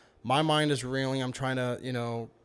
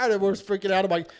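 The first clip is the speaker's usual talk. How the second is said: normal talking voice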